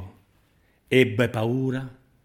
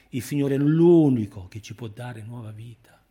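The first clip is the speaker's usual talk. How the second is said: "unico" stretched and swelling louder; stress on L'UNICO; 5 dB louder than usual. Real, not acted. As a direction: lean hard and long on "unico". Preacher intensifies a repeated declaration.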